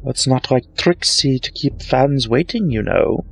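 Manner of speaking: high, showy taunting voice; boastful showmare mocking Twilight; smug Trixie. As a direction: gloat over her rival.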